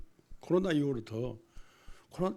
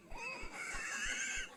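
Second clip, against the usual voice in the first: burst of laughter; 9 dB quieter than usual. Real, not acted. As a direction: burst out laughing at your own joke. Laughs after joke.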